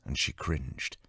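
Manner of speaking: usual speaking voice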